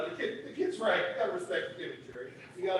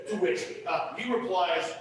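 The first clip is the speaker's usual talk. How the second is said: usual speaking voice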